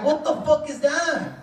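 high, slow, laughing; baffled at the sight; shocked disbelief. Retold or imitated. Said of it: "And I'm like".

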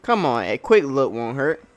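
loud, brash character voice; brash crew member. Acted. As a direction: read it like a cocky crewman. Reads more character lines.

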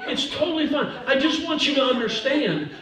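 high-pitched, repeated backpedalling; hasty high-pitched placating; flustered reassurance. Real, not acted. Jokingly placating upset music lady.

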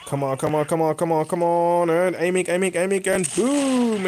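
run-on urging, climbing in pitch to a big boom; excited urging. Real, not acted. Gamer willing his aim onto the target, excited urging. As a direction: build the tension, then let the last word soar.

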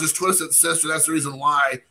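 ordinary talk, nothing marked

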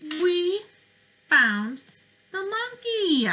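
excited discovery. Acted. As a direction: announce the find with a big thrill. Kids' book discovery moment.

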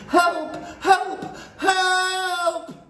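high, repeated cries, the last one stretched out; terrified screaming. A narrator's reading. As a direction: scream desperately for someone to hear. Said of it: "I began to scream".

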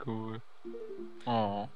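usual speaking voice

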